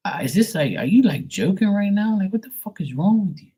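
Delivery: high-pitched incredulous outburst at her dad; exasperated disbelief. Retold or imitated. Retold exasperated rant at dad.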